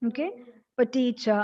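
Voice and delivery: usual speaking voice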